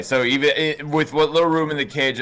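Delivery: ordinary talk, nothing marked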